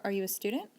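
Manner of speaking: "are you a student" rising on student; question intonation. Shown as a demonstration. Teacher showing a yes-no question, rising intonation. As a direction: ask "are you a student" with the pitch lifting at the end. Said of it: "Now, the question".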